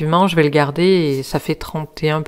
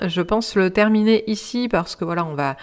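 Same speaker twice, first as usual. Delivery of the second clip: ordinary talk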